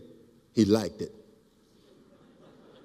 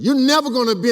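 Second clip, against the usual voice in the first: high, loud mocking yell from the back; taunting shout; 12 semitones higher, 9 dB louder, faster than usual. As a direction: shout a taunt across the stadium. Cousin taunting from the crowd.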